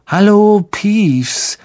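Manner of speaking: ordinary delivery, nothing marked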